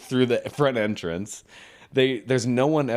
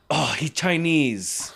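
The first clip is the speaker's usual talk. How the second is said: drawn-out, higher mock Japanese accent; 4 semitones higher, much slower than usual. Sushi worker blaming colleague, mock Japanese accent. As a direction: imitate a Japanese accent, stretching the words. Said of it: "I'll do the accent".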